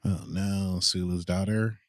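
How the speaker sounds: normal talking voice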